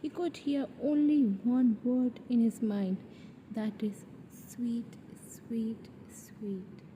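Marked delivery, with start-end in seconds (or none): 4.3-6.8 sweet, softer each time, ending in a whisper; hypnotized inner voice, dying away to a whisper; fading whisper. Acted. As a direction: let the word fade to a breath. Obsessed inner voice repeating word.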